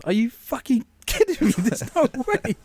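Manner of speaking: high-pitched, laughing disbelief; amused outrage, voice shooting up; incredulous protest. Retold or imitated. Incredulous at the monthly cost.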